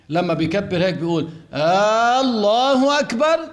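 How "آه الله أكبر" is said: "آه، الله أكبر" stretched and rising high; mock an imam who draws out the opening of "الله"; imitating imams' long hamza. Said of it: "أئمة المساجد هكذا يكبرون". (تقليد مد الهمزة عند بعض الأئمة)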